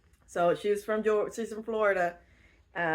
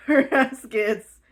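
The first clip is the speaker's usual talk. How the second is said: words through a slow laugh; 5 semitones higher, 8 dB louder, slower than usual. Real, not acted. Vlogger retelling the plot, laughing through the words. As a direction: keep laughing as you say it.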